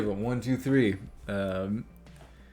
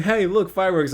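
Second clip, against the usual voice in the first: cheerful call; 10 semitones higher, 8 dB louder than usual. Retold or imitated. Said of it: "pointing at the sky going".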